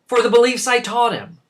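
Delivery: loud, high indignant shouting; angry outburst. Acted. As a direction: explode in wounded indignation. Father rages at son's disobedience.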